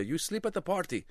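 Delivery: mock Iranian dad; dismissive dad. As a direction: brush the kid off matter-of-factly.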